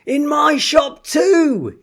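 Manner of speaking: high, loud, drawn-out yell; overjoyed shopkeeper yelling. Acted. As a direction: yell it to the whole street. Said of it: "he yelled".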